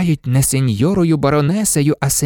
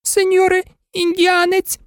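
much higher begging maid voice; pleading old maid; 13 semitones higher than usual. Acted. Maid begs the Indian for mercy, high. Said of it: "заблагала служниця".

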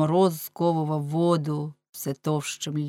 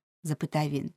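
ordinary delivery, nothing marked

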